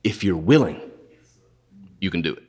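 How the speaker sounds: low, hushed plea, fading away; humble leper's plea. Retold or imitated. Leper to Jesus, hushed and humble. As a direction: ask it low, barely daring. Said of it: "So you know what he says?".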